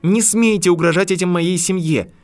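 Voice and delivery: shrill angry yelling at the professor; rising to a scream. A narrator's reading. Harry screams to protect his family, high loud. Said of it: "Голос Гарри становился всё выше, превращаясь в крик".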